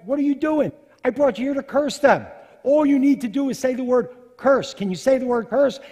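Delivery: loud, high, indignant demand; exasperated shouting. Acted. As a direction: berate him at full volume, incredulous. Balak angrily berates Balaam.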